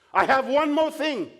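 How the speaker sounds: Balaam hooking Balak with suspense; pressing prophet. Retold or imitated. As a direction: hold the listener on the line. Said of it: "Balaam says".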